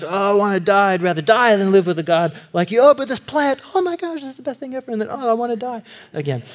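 high, whiny mock tantrum swinging to gushing; toddler Jonah. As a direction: sulk and gush like a toddler. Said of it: "he's like".

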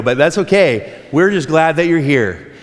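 ordinary talk, nothing marked

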